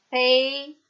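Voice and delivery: "Pay" with a full ay glide, high and loud; contrasted pronunciation. Shown as a demonstration. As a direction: say "Pay" as PAY, held out clearly. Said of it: "ma non si leggono allo stesso modo". ("Pay" con il dittongo "ei", alto e forte)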